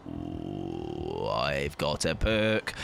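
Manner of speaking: mock deep gloat. Gloating over a new perk.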